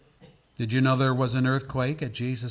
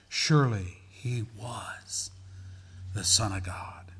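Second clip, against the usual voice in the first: slow, whispered confession; awed hush; 2 semitones lower, slower than usual. Acted. Awed witnesses quoted in a hush.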